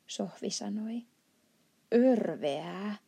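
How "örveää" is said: slow, loud, rough-voiced giant cry; giant's shout. The giant shouting his disgust at fizzy drinks, slow and loud. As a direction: drag the word out like a disgusted giant. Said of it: "huusi I K J".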